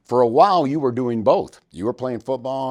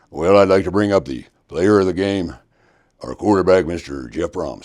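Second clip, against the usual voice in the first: put-on a lightly done, gruff-toned take on the old coach's announcing voice, a bit louder; Schnellenberger voice; 3 semitones lower, 5 dB louder than usual. Radio host retelling the luncheon, lightly imitating the coach introducing the player. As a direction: lightly imitate the coach at the podium, easing off on the name. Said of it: "the impersonations you do are second to none".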